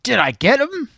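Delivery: excited henchman. Acted. Gunman to partner after shooting, acted urgency. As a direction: pitch it right up, hammed-up. Acting out villains shooting at Phantom.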